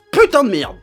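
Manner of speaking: loud furious curse; angry rant. Real, not acted. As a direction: spit it out in rage. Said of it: "je me suis un petit peu énervé".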